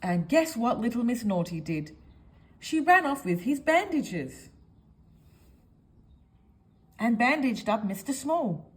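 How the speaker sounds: ordinary delivery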